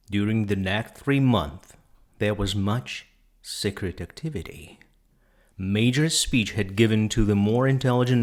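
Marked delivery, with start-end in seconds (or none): none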